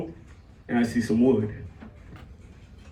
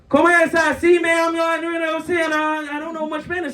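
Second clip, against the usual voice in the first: high-pitched, eager-to-please bluffing; mock Spanish bluff; 8 semitones higher than usual. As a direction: play along in a high put-on voice. Acts himself bluffing in Spanish as [name].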